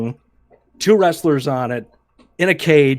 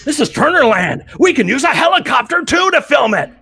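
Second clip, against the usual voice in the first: loud, higher boss proclamation; mock boss shout; 9 semitones higher, 3 dB louder than usual. Boss loudly declaring a helicopter for the shoot. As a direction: announce it grandly.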